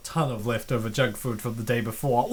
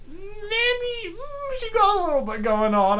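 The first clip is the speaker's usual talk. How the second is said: teasing sing-song. Real, not acted. Teasing about Mandy's weight gain.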